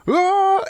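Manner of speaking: very high, loud cartoon scream; mock scream. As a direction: scream like a character about to burst. Chip bag screaming before it pops.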